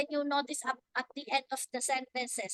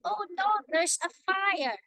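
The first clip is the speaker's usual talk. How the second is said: higher and louder, sudden alarm; alarmed exclamation; 6 semitones higher, 6 dB louder than usual. Shown as a demonstration. Teacher demos an exclamatory sentence to the class, alarmed and loud. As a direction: call it out like you just spotted flames. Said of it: "I will have to tell you this one".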